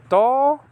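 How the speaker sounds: To said high and clear, alone; Hebrew syllable. Shown as a demonstration. Teacher sounding out the syllable To.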